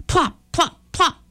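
high, short bouncing plops; playful plopping. A narrator's reading. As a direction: pop each plop out high and separate. Sound of tomatoes landing in story.

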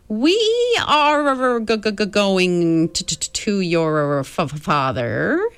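high stammering otherworldly voice, stretched slow; halting high voice that catches on every word; Mrs Which speaking. Acted. Ancient being warns the children of darkness, halting and high.

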